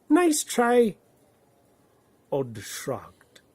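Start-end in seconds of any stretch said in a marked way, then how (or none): 0.1-1.0 high, loud sarcastic "Nice try"; mocking. Fox mocking Odd's rainbow, high and sarcastic. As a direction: tease him for failing.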